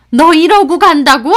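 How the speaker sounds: loud, high outraged question; angry disbelief. Acted. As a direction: confront her as the mother-in-law. Mother-in-law grabs her arm.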